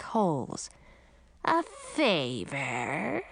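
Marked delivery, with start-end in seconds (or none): 1.4-3.2 slow, low, drawn-out witch question; slow sea witch. Acted. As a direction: stretch it out like a suspicious old witch. Witch answers slowly.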